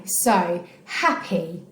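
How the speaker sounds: slow model word. Shown as a demonstration.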